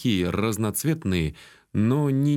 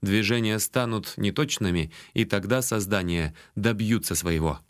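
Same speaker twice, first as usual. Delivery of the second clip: usual speaking voice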